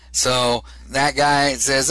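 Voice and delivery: normal talking voice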